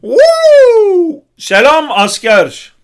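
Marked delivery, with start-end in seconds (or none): 0.0-1.2 loud, much higher woo whoops; whoop of excitement. Real, not acted. Gamer whooping loudly at a great play, thrilled. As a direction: let out two high loud whoops.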